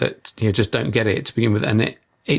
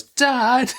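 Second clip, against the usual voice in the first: mock child shout; 13 semitones higher than usual. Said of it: "she'd shout out the top of her voice".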